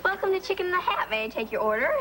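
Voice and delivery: high-pitched service greeting; chide, then pitch up into the scripted welcome; model customer greeting. Shown as a demonstration. Waitress corrects trainee before a customer, demonstrating the greeting.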